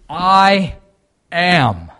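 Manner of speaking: I and AM each boomed alone; emphatic proclamation. Acted. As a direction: proclaim "I am" loudly, a pause between words. Preacher proclaims Jesus' key words.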